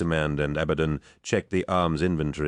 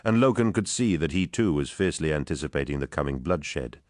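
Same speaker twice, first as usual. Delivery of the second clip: plain reading voice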